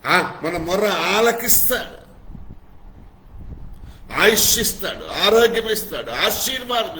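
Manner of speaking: high, loud preacher's cry, building; full-force preaching. Acted. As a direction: pile up the blessings at the top of your voice.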